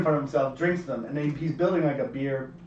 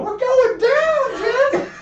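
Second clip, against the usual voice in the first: very high, loud and slow yelling; mock alarm shout; 9 dB louder, much slower than usual. Prankster waking sleeping friend on a plane, mock alarm shout. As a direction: yell it like the plane's crashing. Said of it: "and I go".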